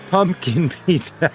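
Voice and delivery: laughing disbelief. Real, not acted. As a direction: say "Pumpkin pizza" high and slowly, breaking into a laugh on "Pumpkin". Repeats it again, laughing.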